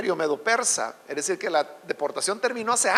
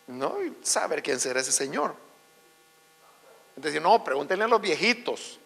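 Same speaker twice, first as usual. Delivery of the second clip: low, soft, offhand reply; acted clueless bystander, voice dropped; dismissive shrug; 7 semitones lower, 6 dB quieter than usual. Townspeople who never heard of Daniel, offhand dismissive answer.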